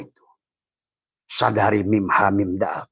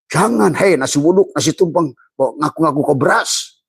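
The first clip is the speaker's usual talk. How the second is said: high, louder teasing rebuke; mock scolding; 6 semitones higher, 4 dB louder than usual. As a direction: tease them like a scolding elder. Scolding the other rice dishes.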